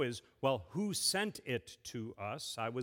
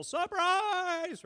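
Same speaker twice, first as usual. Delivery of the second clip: a sudden, high, loud party shout; yelling surprise; 7 dB louder than usual. Retold or imitated. Pastor to children, mimics a party crowd yelling. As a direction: jump out and yell it at the guest. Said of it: "a bunch of people who yell".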